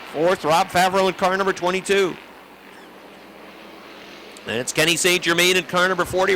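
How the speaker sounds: brisk live race play-by-play; motorsport commentator. Acted. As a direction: call the race as it unfolds.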